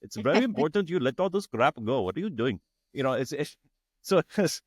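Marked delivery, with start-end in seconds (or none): 0.0-2.0 guru's words in a lightly put-on Indian accent; thick Indian accent. Guru urging him to drop everything, in Indian accent. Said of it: "thick Indian accent". 2.0-2.6 guru's thick Indian accent, lightly put on. Guru telling him to let go, in a light Indian accent. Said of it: "she would say it in big, thick Indian accent".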